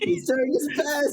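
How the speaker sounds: very high, squeaky protest; mock pleading defence. Game master defending Lyre after a miss, high mock protest.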